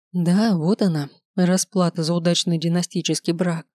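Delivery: ordinary delivery, nothing marked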